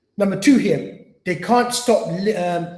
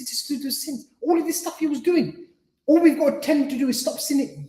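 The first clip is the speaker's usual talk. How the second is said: insistent retort; 8 semitones higher than usual. Retold or imitated. His retort to the doubter.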